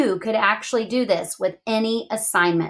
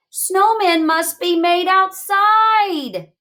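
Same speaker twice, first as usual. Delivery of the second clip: slow, higher, louder parental scold; parents scolding; 8 semitones higher, 4 dB louder, slower than usual. Acted. Mom and Dad telling Melinda, slow and firm. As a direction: lay down the rule slowly, like a parent. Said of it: "Mom and Dad said".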